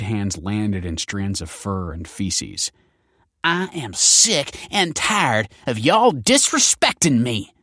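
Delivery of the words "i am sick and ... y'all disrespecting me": slow, high snarled threat; snarling. Acted. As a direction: snarl it out slowly. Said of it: "snarled Stacy".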